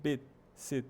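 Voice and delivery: "Bit, sit" with tiny lax i; very short vowel. Shown as a demonstration. Teacher stressing how brief it is, short i in "Bit, sit". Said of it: "esse bit já é bem curtinho".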